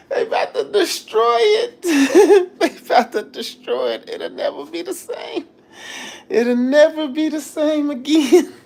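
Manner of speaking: high mock sobbing broken by laughter; exaggerated tearful wail, half laughing; comic crying. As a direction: blubber it out high, hamming up your own heartbreak. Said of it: "I was in there crying like Paul Blart".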